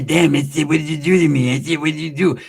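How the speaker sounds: put-on slurred complaint; Pepe's protest. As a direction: garble it like a baffled man. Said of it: "What will Pepe say?".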